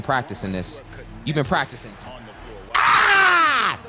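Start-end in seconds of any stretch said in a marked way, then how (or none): 2.7-3.8 loud, high yell; frustrated scream. Real, not acted. Gamer after trying a practiced move, frustrated scream. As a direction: let out a piercing open-throated yell. Outburst during play.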